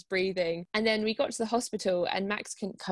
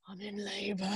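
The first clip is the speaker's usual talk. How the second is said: much softer, strained repeat; barely able to speak; 9 dB quieter than usual. Retold or imitated. Woman in labour repeating herself to the desk guy, hardly able to speak. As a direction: force it out faintly, with hardly any voice. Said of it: "like, couldn't speak".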